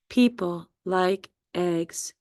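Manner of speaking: slow, word-by-word People like eggs; measured teacher's mnemonic, each word separate; memory phrase. Shown as a demonstration. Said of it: "just think of".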